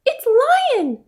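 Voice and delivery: high squeaky mouse; tiny mouse recognizing the roar. A narrator's reading. Mouse hearing the lion, high and squeaky. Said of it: "she said".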